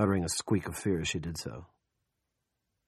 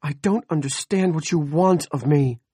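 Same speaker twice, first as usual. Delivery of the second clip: upset woman; 8 semitones higher, 9 dB louder than usual. Acted. Andrea confused, upset.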